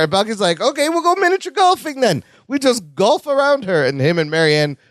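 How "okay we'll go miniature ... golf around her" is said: high, eager sitcom voice; silly high-pitched enthusiasm; chirpy Bucky. Retold or imitated. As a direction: pitch it way up, playfully upbeat. Voicing Bucky's silly golfing suggestion.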